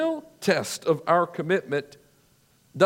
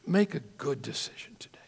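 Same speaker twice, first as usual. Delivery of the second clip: hushed whispered repeat, softer; 3 semitones lower, 6 dB quieter than usual. Real, not acted. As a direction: whisper it close, urging.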